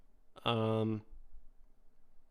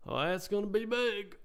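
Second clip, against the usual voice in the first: surprised; 10 semitones higher than usual. Real, not acted. Reacts to stock drop.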